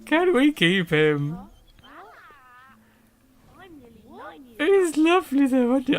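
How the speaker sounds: loud, high begging; pleading squeal. Pretend. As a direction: drag out the plea, high and loud. Begging to keep the animal.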